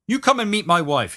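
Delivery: high-pitched, insistent invitation; pushy bumpster. A narrator's reading. Bumpster presses his invitation.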